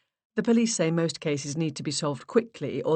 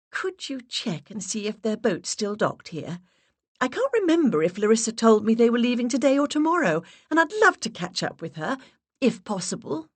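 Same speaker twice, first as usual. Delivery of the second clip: high breathy put-on voice; 7 semitones higher than usual. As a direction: voice raised an octave, stronger English accent. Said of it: "I raised my voice an octave".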